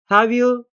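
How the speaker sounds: "have you" rising high at the end; rising question tag. Shown as a demonstration. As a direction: lift the voice on "have you", really asking. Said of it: "when our tone goes up".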